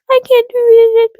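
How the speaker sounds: mocking high whiny excuse; whiny viewer. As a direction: whine like a lazy excuse-maker. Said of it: "you're probably like".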